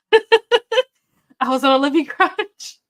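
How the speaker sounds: high, loud words broken by laughter; laughing. Real, not acted. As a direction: laugh your way slowly through it. Laughs while reading a viewer's comment.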